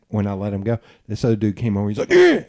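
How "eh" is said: sudden loud, high "eh!"; aggressive grunt. Retold or imitated. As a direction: imitate someone butting in with a noise. Imitates other dude barging in.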